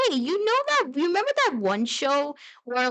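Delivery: high, eager recollection; excited fan. Acted. Imagined grown-up viewer recalling that one show, excited. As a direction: gush like someone remembering a childhood show.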